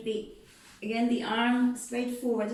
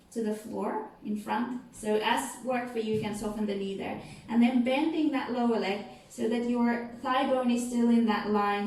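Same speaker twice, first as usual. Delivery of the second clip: usual speaking voice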